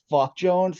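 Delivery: sarcastic. Shown as a demonstration. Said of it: "like you're being sarcastic".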